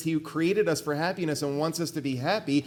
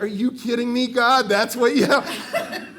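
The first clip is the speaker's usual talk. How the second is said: incredulous; 8 semitones higher, 8 dB louder than usual. Retold or imitated. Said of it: "I'm like".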